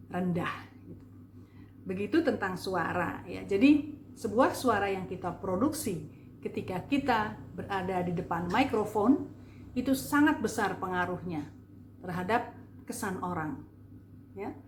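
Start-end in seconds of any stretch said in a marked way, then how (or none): none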